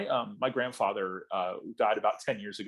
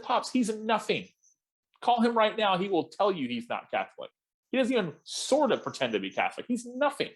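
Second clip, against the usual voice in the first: exasperated; 10 semitones higher than usual. Retold or imitated. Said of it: "I said".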